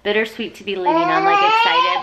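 near tears, voice jumping up on excited; crying through a happy word; teary and emotional. Real, not acted. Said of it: "So it's".

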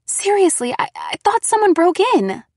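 high, loud, stammering protest; shaken reproach. Acted. Girl thought someone broke in, shaken protest. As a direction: protest high, still full of fright.

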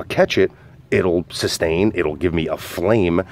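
ordinary delivery

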